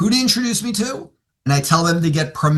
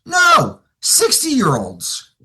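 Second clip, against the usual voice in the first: ordinary talk, nothing marked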